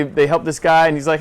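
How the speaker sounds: normal talking voice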